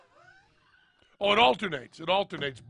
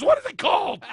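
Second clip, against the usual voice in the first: loud quiz question; 12 semitones higher, 10 dB louder than usual. Retold or imitated. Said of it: "He goes".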